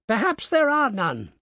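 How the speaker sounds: put-on high, loud snapping old woman; angry. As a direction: snap at him crossly. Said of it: "she looked angrily at him".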